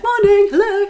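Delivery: high, loud greetings to the neighbours; cheery greeting. Retold or imitated. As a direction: call out hello down the street. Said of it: "I'm all like".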